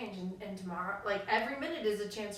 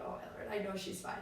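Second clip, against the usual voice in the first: much softer, under-the-breath exasperation; muttered aside; 4 semitones lower, 8 dB quieter, faster than usual. Retold or imitated. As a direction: mutter it so the kid can't hear. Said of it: "you're like".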